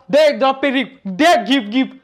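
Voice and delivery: high, loud thwacks acted out in words; mimicking a thrashing, hit by hit; imitated blows. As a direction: punch out each blow like a sound effect.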